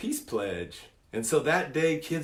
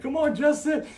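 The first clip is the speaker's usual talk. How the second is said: much higher, teasing push; playful coaxing; 14 semitones higher, 4 dB louder than usual. Retold or imitated. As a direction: nudge him along with a grin.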